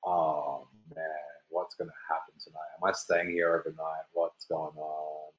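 low, hushed dread, retold; worried self-talk. Teen alone in cell, worrying.

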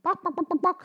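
high clucking bok bok; chicken clucking. Acted. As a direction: cluck like a hen. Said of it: "said the chicken".

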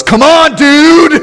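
put-on high, loud kid yelling at a slow driver; impatient kid yell. Son yelling at a slow car ahead, impatient high shout. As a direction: shout it from the back seat, fed up. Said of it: "Noah from the very back seat goes".

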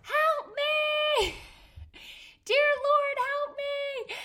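very high, loud re-enacted scream for help. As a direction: cry out to heaven at full pitch. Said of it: "I start screaming".

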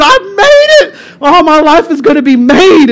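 high, elated cartoon sheep; excited sheep. Sheep celebrates reaching dry land, high and elated. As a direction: burst out in high squeaky joy. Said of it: "He's excited".

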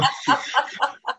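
a giggle finally breaking out; burst of laughter. Real, not acted. Bursts out laughing at own joke.

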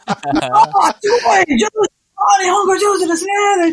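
very high, squealing mock delight; gushing praise. Japanese locals praising her few words, high excited gushing. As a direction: gush like an impressed local.

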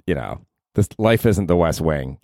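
ordinary delivery

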